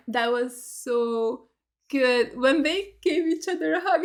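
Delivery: ordinary delivery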